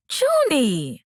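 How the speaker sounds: high, loud shocked shriek; shocked screech. A narrator's reading. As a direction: screech her name in shock. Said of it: "she screeched".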